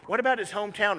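usual speaking voice

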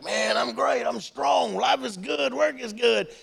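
high, upbeat rattled-off boast; hearty boasting. Acted. Young churchgoer answering how he's doing, hearty boasting. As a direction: brag like you are in your prime.

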